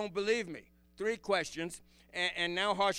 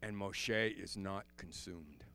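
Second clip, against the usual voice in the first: hushed awe; 8 semitones lower, 6 dB quieter than usual. Real, not acted. Preacher marveling at God's mercy, low and hushed. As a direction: sink to a reverent hush.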